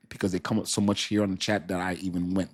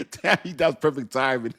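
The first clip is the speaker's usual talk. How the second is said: higher pitch, breaking into laughter; laughing; 7 semitones higher than usual. Real, not acted. Streamer praising a viewer's timing, laughing. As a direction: say it while cracking up. Cracking up at chat teasing.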